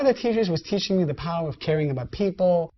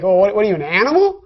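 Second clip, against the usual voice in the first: louder, half-mock outrage at a student; mock-indignant rebuke; indignant scolding; 7 dB louder, faster than usual. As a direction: play the shocked teacher, raised voice. Teacher scolding in retold story.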